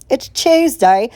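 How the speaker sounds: playful British "Tuesday", said like "Chewsday"; British accent. Pretend. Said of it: "Am I gonna morph into an accent?".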